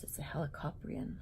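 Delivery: usual speaking voice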